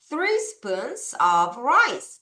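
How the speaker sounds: very slow "Three spoons of rice"; slow lead reading; slow choral reading. Shown as a demonstration. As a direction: lead the class slowly. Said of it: "같이 한번 읽어볼까요".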